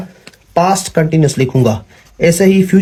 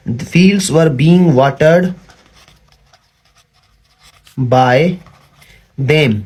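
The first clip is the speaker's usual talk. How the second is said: slow dictation; much slower than usual. Shown as a demonstration. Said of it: "ये आपका एक passive बन गया".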